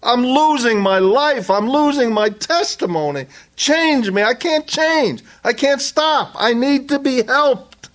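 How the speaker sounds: high, pleading voice of a desperate believer; a struggler crying out to God in a high plea; pleading sinner. Retold or imitated. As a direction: beg God for change, voice climbing. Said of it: "and they're saying".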